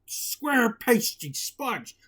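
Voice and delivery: loud, slow giant bellow; hammed-up giant shouting. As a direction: boom it out like a giant sniffing prey. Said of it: "shouted the giant".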